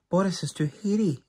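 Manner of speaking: mild mock yell from a little mouse. A narrator's reading. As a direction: blurt it out quick and high like a frightened mouse. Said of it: "yelled another".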